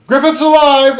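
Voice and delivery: loud, high call; hammed-up discovery shout; excited shout. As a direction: cry it out in relief. Guts calling out.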